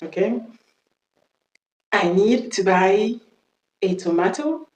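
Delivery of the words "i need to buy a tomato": slow British "tomato", to-MAH-to; British accent. Shown as a demonstration. Teacher modelling the sentence slowly, British accent. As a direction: say "tomato" with a long "ah" and crisp t, British. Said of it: "on va faire l'accent british".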